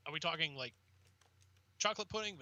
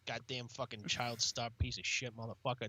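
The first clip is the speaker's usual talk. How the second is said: mock grumbling; 6 semitones lower than usual. Grumbling as irritated actor.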